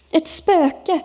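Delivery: high, alarmed exclamation; frightened cry. A narrator's reading. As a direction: cry out at sighting a ghost. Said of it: "ropade Thomas och pekade". (uppskrämt utrop med hög röst)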